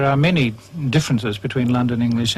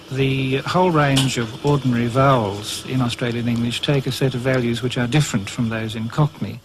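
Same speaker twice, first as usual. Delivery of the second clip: ordinary delivery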